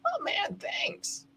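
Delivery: high, then a whispered thanks; a hint of breezy gratitude, whispered at the end. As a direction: brush it off lightly, the thanks breathed. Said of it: "they should be like".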